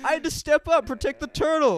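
mock hero. Friend hams up a dream hero protecting the turtle, mock-heroic. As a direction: puff up like a pretend hero defending the turtle.

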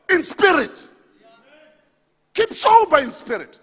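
high, loud scripture proclamation; full-force preaching. Real, not acted. Pastor proclaiming Peter's verse to congregation, full-force preaching. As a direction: call the verse out far above talking pitch.

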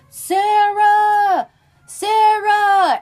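loud, high-pitched search call. Retold or imitated. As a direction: holler a name across a forest, imitating searchers. Acts out searchers calling out.

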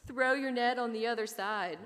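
incredulous mock call; mocking quote of stranger. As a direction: mimic his advice as if it were ridiculous. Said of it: "Listen to him".

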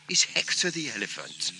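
usual speaking voice

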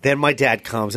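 usual speaking voice

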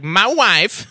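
Borat impression. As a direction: punch it like Borat. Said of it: "where he's like".

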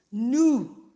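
loud astonished "nu"; surprised nu. Retold or imitated. Teacher imitating a youth amazed at a car. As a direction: gasp out "nu" at something big. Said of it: "A pessoa fica espantada".

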